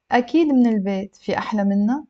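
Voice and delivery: fluent, straight on into the question; slightly brisk model reading; connected speech for learners. Shown as a demonstration. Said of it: "I'll read it a little bit faster".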